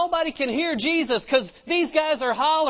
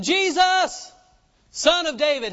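high, raised cry to Jesus; hollering; 5 semitones higher, 3 dB louder than usual. Retold or imitated. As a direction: holler as a retold blind beggar. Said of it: "And they hollered louder".

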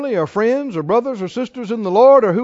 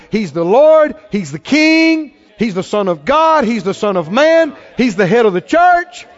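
preacher lifting his church toward a shout; rising preaching; 4 semitones higher than usual. Acted. Preacher to congregation, building to a joyful shout.

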